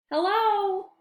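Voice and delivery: put-on high, bright girl's hello; a small girl calling hello. Girl voice greeting in story.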